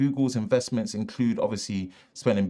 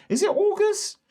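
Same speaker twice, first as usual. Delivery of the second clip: surprised. Real, not acted. Sudden high question.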